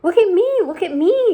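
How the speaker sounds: high, loud gleeful shout; excited shout. A narrator's reading. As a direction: show off to everyone. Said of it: "shouted the centipede excitedly".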